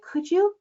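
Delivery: "Could you?" pitched high and lifting; wishing rise. Shown as a demonstration. Coach mocking a hopeful question, high and rising. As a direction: float it up like a hopeful wish. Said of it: "that wishing mode".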